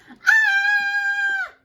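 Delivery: put-on very high, loud girlish scream. Goldilocks waking to the bears, high terrified scream. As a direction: scream in fright. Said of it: "Goldilocks woke up and screamed".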